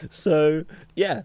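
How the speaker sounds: ordinary talk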